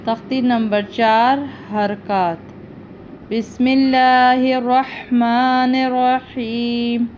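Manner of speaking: slow, drawn-out Quranic recitation; measured chanting of the opening verses; reverent recitation. Acted. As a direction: intone it slowly and devoutly. Opening recitation before lesson.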